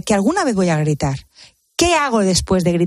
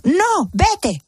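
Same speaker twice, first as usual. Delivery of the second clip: put-on high, pushy child's no; a little girl refusing a hug, higher; sulky child; 11 semitones higher than usual. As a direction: play the upset daughter pushing mum away. Angry child voiced.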